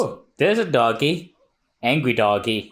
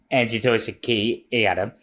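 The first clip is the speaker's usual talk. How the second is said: usual speaking voice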